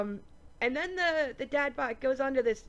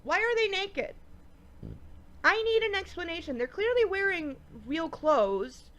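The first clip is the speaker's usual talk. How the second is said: higher, baffled disbelief; exasperated; 7 semitones higher than usual. Real, not acted. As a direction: demand an answer, exasperated. Exasperated about the nudity.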